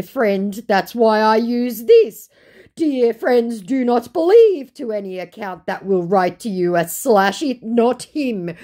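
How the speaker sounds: imitating the scammer; mock scammer. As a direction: parody the scammer's excuse. Scammer's last message.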